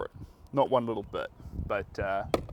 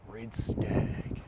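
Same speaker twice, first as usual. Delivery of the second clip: whispered name. Real, not acted. Savouring the painting's name.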